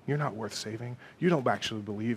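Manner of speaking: hushed, low insinuation; Satan's whispered lies. Acted. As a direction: plant the doubt softly. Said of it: "And he will go".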